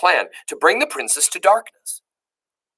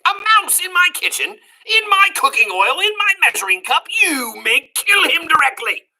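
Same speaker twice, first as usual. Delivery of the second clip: horrified cook; 13 semitones higher, 3 dB louder than usual. Acted.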